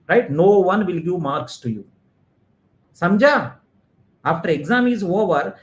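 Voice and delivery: usual speaking voice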